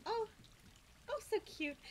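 high and loud with joy; thrilled high voice; delighted squeal. Real, not acted. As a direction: squeal as if tickled. Kitten licks her.

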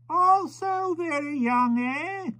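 put-on high, slow old man; musing elderly aside; slow, high, trailing old voice; old stranger's voice. Old stranger remarking on the baby.

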